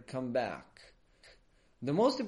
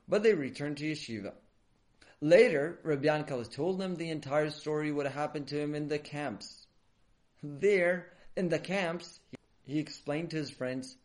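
ordinary delivery

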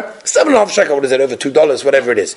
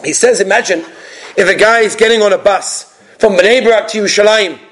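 normal talking voice

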